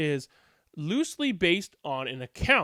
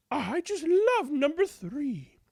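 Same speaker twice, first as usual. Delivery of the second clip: dreamy villager; 10 semitones higher, slower than usual. A narrator's reading. Villager praising.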